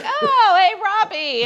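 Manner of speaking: excited greeting. Retold or imitated. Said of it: "and they're like".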